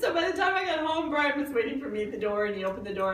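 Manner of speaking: high, loud words through real laughter; giggling helplessly at yourself; laughing hard. Real, not acted. Laughing while retelling.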